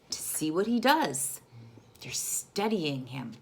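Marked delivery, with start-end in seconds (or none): 2.0-3.3 hushed secretive whisper. A narrator's reading. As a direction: keep it secret from the wizard. Whispered suspense aside.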